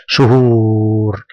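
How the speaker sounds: شهور with the ū held long and level; long drawn-out vowel. Shown as a demonstration. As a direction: stretch the و in شهور on one steady note. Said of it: "مد الصوت للحرف الذي قبله بالضم". (مدّ الواو طويلًا في «شهور»)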